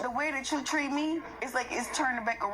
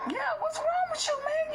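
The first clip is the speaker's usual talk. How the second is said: incredulous outburst. Real, not acted. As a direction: voice jumps way up in disbelief. Heated argument with ex-partner.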